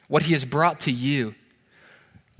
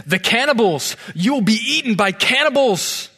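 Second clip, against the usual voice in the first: high, loud cry of alarm, acted out; alarmed warning; 8 semitones higher, 5 dB louder than usual. Friend warns the missionary about cannibals, acted alarmed outcry. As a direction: exclaim it in mock horror, pitched up and loud.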